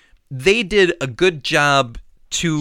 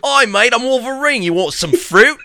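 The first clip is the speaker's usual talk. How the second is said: higher, louder hammed-up Aussie accent; playful Australian accent offering fruit; mock Australian Wolverine; 9 semitones higher, 5 dB louder than usual. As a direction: ham up a cheery Australian bloke. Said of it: "he's like".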